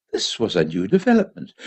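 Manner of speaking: usual speaking voice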